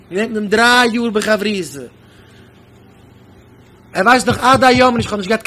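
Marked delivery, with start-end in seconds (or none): none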